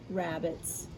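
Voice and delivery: ordinary delivery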